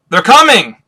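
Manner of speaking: high, loud cry; acted alarm; shouted warning. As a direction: yell out a lookout's alert.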